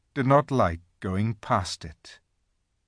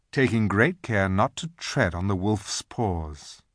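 usual speaking voice

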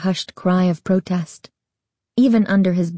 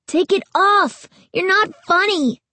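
shouting; 11 semitones higher than usual. A narrator's reading. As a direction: yell it in fear. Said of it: "her brother shouted".

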